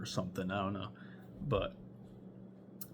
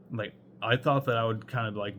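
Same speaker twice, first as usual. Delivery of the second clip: ordinary delivery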